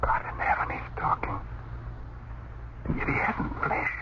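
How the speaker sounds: hushed, unvoiced whisper of disbelief; awed whisper. Husband whispers in shock that the ghost speaks. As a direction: whisper it in stunned awe.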